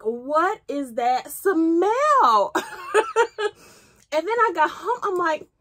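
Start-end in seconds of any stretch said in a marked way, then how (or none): 0.0-2.5 slow, loud, laughing wonder; stunned by a scent, drawn out; amazed. Retold or imitated. First smells it in store. 2.6-3.5 high, bright burst of laughter; delighted laugh. Real, not acted. Laugh after the memory.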